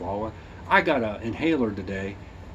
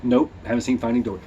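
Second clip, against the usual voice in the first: ordinary talk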